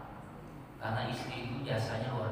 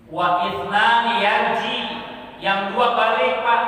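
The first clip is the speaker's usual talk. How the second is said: loud, drawn-out call of the hadith; preaching shout; 8 semitones higher, 11 dB louder, slower than usual. Acted. Preacher calling out the hadith to the congregation, loud and slow. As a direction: belt it to the congregation.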